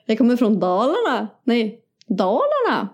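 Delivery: swooping Dalarna lilt; Dalarna accent. Acted. Showing the only possible phrase, Dalarna accent. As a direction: exaggerate the melody of dalmål. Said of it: "Dalmål".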